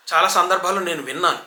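ordinary talk, nothing marked

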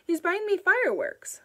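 put-on high, loud sulky child voice; a boy pouting back at his mother; sullen boy. A narrator's reading. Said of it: "Wayne said sullenly".

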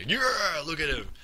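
excited cheer. Real, not acted. Cheering his ball carrier.

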